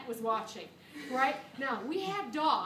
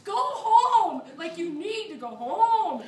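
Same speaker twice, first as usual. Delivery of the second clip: louder and higher, laughing yell; yelling go home; 5 semitones higher, 10 dB louder, much slower than usual. Retold or imitated. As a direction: shout it at someone who can't hear. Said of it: "I'm yelling".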